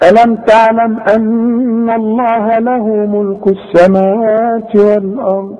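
slow melodic tajweed chant; Quran recitation. Acted. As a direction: recite slowly with held vowels.

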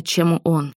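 usual speaking voice

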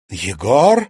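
loud call swooping upward; indignant, rising exclamation; angry recognition. A narrator's reading. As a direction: call the name out in anger. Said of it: "одержимая девушка гневно уставилась".

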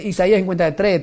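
ordinary delivery

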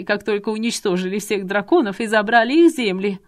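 a madwoman ranting about her stolen inheritance; manic rant. Acted. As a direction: rave about her birthright. Madwoman rants about dragon destiny.

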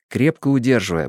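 plain reading voice

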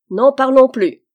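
captain cheerfully calling out to end the argument; cheerful exclamation. A narrator's reading. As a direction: brush the topic aside with a hearty shout. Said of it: "s'écria gaiement le capitaine".

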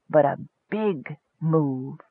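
slow, soft dream voice; gently insistent. A narrator's reading. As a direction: press the idea softly, drawing out each word. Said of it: "the voice insisted".

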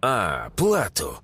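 slow, low mock realisation; smirking. A narrator's reading. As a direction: draw it out with a slight smirk. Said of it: "усмехнулся Феликс". (с усмешкой, растягивая)

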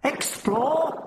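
very high creature echo; incredulous, high-pitched insect-creature query; hostile, scornful alien. A narrator's reading. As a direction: throw the word back scornfully as an alien. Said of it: "repeated, hostile, scornful".

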